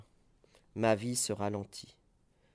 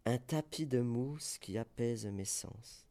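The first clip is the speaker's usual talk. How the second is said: normal talking voice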